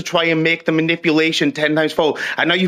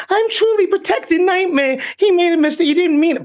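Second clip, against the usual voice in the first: mocking high whine; 11 semitones higher than usual. Streamer mocking his rival's claim in a high sarcastic voice. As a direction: sneer it in a high, syrupy mock voice. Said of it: "while saying".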